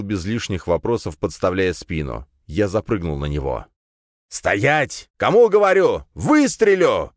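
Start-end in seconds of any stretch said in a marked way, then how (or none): 4.3-7.1 put-on loud high old man shout. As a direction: bellow a threat to stop. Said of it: "крикнул старик".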